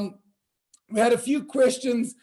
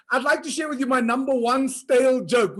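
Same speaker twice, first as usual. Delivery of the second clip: normal talking voice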